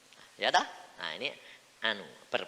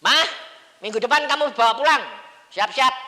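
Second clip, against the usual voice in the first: high, loud, quick announcement; calling to Mama; 10 semitones higher, 14 dB louder, faster than usual. Retold or imitated. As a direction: shout the order briskly. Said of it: "Saya bilang sama mama". (seruan keras kepada Mama)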